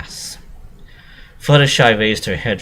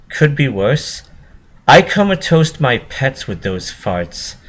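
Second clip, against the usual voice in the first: higher, louder indignant challenge; pony daring her friend, getting louder to the end; 7 semitones higher, 4 dB louder than usual. A narrator's reading. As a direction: dare her, offended.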